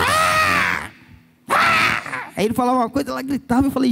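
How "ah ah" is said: put-on high child's shriek, far higher pitched; retold child scream. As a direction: shriek like a tantrum toddler. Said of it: "ela só gritava".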